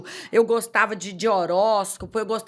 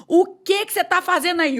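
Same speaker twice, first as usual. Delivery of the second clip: higher, louder stern parent's challenge; scolding parent; 9 semitones higher, 5 dB louder than usual. Retold or imitated. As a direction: catch a child in the act. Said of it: "como um pai quando chega".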